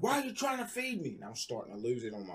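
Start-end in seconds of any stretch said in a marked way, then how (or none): 0.0-1.2 high, suspicious accusing cry; flaring up at mom in paranoia; paranoid outburst. Retold or imitated. Sleepless son snapping at his mom, high and suspicious. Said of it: "finally I was like".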